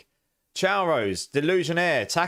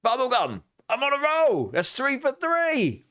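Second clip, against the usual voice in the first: triumphant squeal; 12 semitones higher, slower than usual. Real, not acted. Streamer celebrating a lucky bean streak, squealing. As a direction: crow it out in a much higher voice. Triumphant after guessing right.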